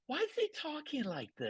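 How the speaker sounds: a high hushed voice from the audience's head; Gaffigan aside voice. Retold or imitated. Mocking the comedian's audience aside to the host, high hushed voice. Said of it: "And he does it".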